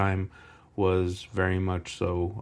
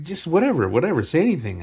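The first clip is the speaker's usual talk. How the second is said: insistent schoolmate; 9 semitones higher, 6 dB louder, faster than usual. Retold or imitated. Voices schoolmate pressing him to speak.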